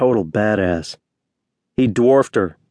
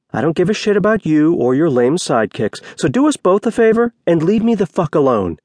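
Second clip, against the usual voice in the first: high, heated dismissal; fed up, pushing them away; angry telling-off; 7 semitones higher than usual. A narrator's reading. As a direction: tell them off, pitch climbing. Heroine tells off her ex.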